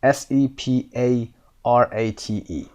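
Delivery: slow, even letter-by-letter spelling; spelled letter by letter. Shown as a demonstration. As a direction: spell it out clearly for the class. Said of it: "spelling the word aloud".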